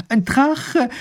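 high, loud holler; calling from afar. A narrator's reading. Wife calling to her husband from afar, loud. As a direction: shout the alarm across a distance. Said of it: "ruft sie ihm schon von Weitem zu".

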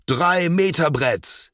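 high, drawn-out excited call; loud call. A narrator's reading. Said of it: "rief Lars laut".

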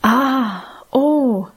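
drawn-out ah and oh in wonder; amazed gasp. A narrator's reading. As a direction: marvel at the sight. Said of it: "wir staunten allesamt".

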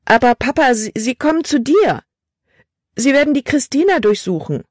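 bubbling-over alarm. Acted. Daughter warning her father of the search, words spilling out urgently. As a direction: plead anxiously, pitch lifted throughout. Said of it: "Die Worte sprudelten aus Libby heraus".

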